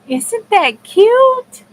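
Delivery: high, loud and slow delight; gushing. Real, not acted. Crafter coos to her viewers over her cute creation. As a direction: coo over how cute it is.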